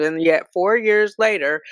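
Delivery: usual speaking voice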